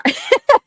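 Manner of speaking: sudden high burst of a laugh; high giggle. Real, not acted. Laughing at her own slip with a high giggle.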